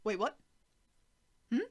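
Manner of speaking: plain reading voice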